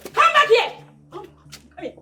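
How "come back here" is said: sharp high yell; commanding shout. Acted. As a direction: bark the order. Queen orders them back.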